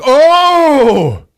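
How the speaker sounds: high, loud, drawn-out "oh"; hammed-up howl of dismay; mock cry. Villain sees the monster shot, loud mock cry. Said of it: "he's like".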